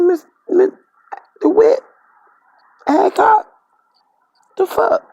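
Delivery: high, loud shocked yell; shocked outcry. Retold or imitated. Shocked yell at the neighbor after the shooting.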